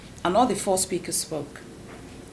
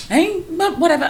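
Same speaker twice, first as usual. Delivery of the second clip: dismissive wife; 10 semitones higher, 6 dB louder than usual. Retold or imitated. Wife brushing off husband.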